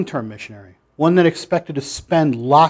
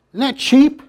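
indignant; 9 semitones higher than usual. Real, not acted. Preacher indignant rhetorical question.

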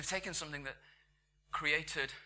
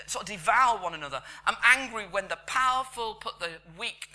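loud, raised, impassioned declarations; angry God; 5 semitones higher, 8 dB louder than usual. Acted. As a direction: thunder out each grievance, slowing toward the end. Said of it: "that sort of anger of a God who goes".

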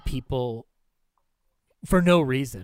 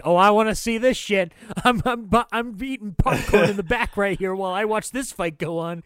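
higher, gleeful and thrilled at a fight; like someone digging into popcorn for the show; eager onlooker; 6 semitones higher, 4 dB louder than usual. Retold or imitated. Host mimics Rick relishing the brawl, gleefully excited. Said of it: "he's like".

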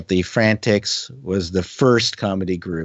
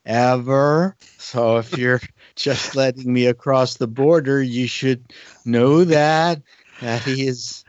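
slow, higher, drawn-out fawning praise; mock flattery; 5 semitones higher, slower than usual. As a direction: ham up the adoration, stretching the words. Exaggerated flattery hoping for US work.

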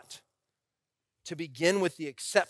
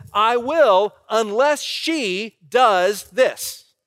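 higher, louder, slow conditional vow; mock a groom spelling out his condition; imagined groom; 8 semitones higher, 8 dB louder, much slower than usual. Groom answering the pastor with a condition, mock-emphatic. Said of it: "the groom looked at me and said".

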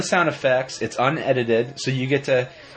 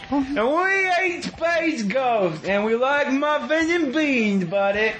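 silly cartoon voice; 9 semitones higher than usual.

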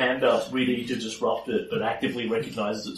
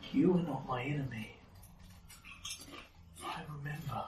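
quiet; 13 dB quieter than usual. Acted. NPC speaks after eyes stop burning.

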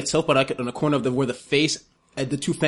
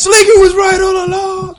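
mock panicked shout; 9 dB louder than usual. Player joins Greg's panicked flight, screaming. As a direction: wail the revelation at full voice.

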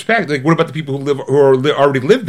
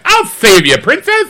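mock heroic prince; 11 semitones higher, 3 dB louder than usual. Mocking the rescuing prince.

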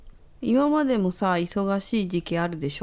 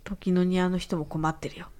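ordinary delivery

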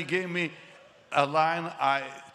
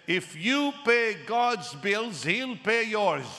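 weighty pronouncement; 8 semitones higher, slower than usual. Retold or imitated. Father-in-law's big line.

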